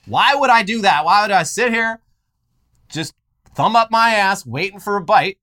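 loud, high, indignant gun-fisherman rant. Pretend. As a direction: rant like an outraged yokel who fishes with bullets.

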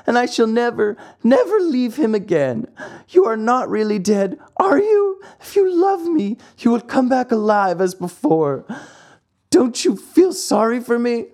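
high, wailing puppet's grief; sobbing lament. A narrator's reading. Pinocchio mourning his dead fairy alone, sobbing lament. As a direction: plead and wail like a heartbroken child. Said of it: "As he sobbed, he said to himself".

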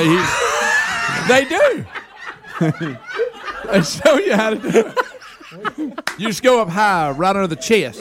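laughing through the words; cracking up mid-sentence. Real, not acted. Laughing while explaining.

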